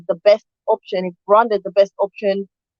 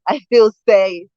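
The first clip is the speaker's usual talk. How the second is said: normal talking voice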